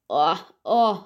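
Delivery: ordinary talk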